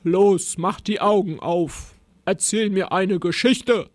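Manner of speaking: gruff, forceful monster command; snapping monster. A narrator's reading. Monster demanding a story from Rosa, snapping gruffly. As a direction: order her about with a growl. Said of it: "schnauzte das Monster".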